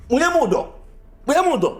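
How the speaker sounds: very high-pitched, agitated repeated cries; heated challenge. Acted. Man challenging his accusers in a quarrel, heatedly. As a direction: throw it out high and worked up, like a dare.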